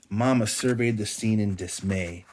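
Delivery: ordinary delivery, nothing marked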